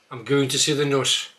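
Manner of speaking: I'm going to see the nurse with a short nuss vowel. Shown as a demonstration. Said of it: "start with a few words".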